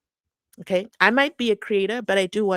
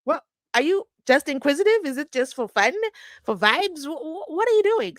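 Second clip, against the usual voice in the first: high, loud, incredulous challenge to the judge; mock indignant advocate; 5 semitones higher, 6 dB louder than usual. The advocate confronting the judge, mock indignant and loud. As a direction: ham up the lawyer scolding the bench. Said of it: "he says".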